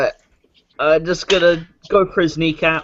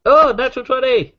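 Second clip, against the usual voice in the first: high-pitched delighted outburst; excited; 8 semitones higher, 3 dB louder than usual. Real, not acted. Player sees the dice land on a natural twenty, excited. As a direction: burst out thrilled at a lucky roll.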